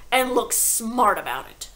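loud, slow command; shouted order. A narrator's reading. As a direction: snap it like a tyrant. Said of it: "the Trunchbull shouted".

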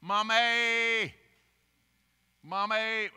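put-on loud, high child's yell for mommy; toddler yelling. Grandpa imitating the toddler yelling loud and high for mommy. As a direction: call out like a toddler in the night. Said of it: "this is how they yell for mommy".

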